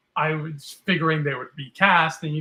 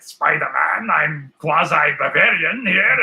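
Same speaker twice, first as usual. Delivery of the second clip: slow, louder put-on Bavarian villain voice; mock Bavarian accent; 7 dB louder, slower than usual. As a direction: ham up a Bavarian cartoon villain. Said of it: "He's all like".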